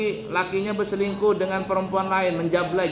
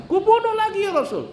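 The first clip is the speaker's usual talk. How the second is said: very high, indignant cry; acted fury; 14 semitones higher, 4 dB louder than usual. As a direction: play a son outraged for his mother. Acted son outraged over mother.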